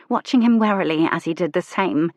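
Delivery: ordinary delivery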